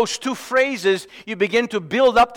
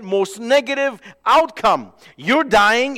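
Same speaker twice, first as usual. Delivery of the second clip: usual speaking voice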